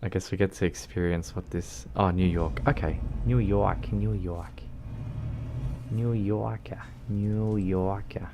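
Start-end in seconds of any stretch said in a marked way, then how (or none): 2.0-8.3 "New York" stretched out with a playful lilt, the last "New Yorker" slow; drawn-out New York. Shown as a demonstration. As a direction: savour "New York" slowly like a funny word. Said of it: "I just can't help but say it like that".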